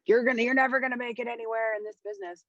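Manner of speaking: fast, high mocking put-down; scornful jazz producer. Imitating the jazz producer on a phone call, fast mocking put-down. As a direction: sneer it out like a know-it-all producer brushing you off. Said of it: "he's like".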